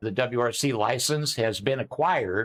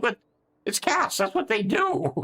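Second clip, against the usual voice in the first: exasperated retort; 10 semitones higher than usual. Retold or imitated. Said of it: "I'm like".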